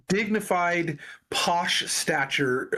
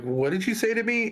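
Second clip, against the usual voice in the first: confused surprise; 7 semitones higher than usual. Retold or imitated. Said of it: "they're like".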